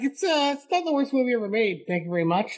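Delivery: huffy "it's not the worst movie ever made"; indignant retort. Retold or imitated. Retelling arguing with a store clerk, mock indignant. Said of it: "I was like".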